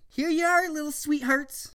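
cooing. Acted. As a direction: coo at tiny pets. Zach coos to pets.

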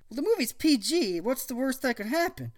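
put-on teen boy voice. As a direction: play a smug cool teen. Zach voiced.

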